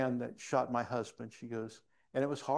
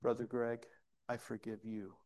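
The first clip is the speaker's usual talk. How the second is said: gently retold, sinking to a hushed close; retold tearful forgiveness; 2 semitones lower, 7 dB quieter than usual. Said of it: "again, tears, fresh tears".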